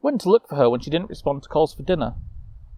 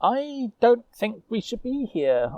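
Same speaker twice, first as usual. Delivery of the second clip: uneasy knight; 9 semitones higher, slower than usual. Acted. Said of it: "Osmond replied".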